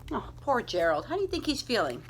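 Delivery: usual speaking voice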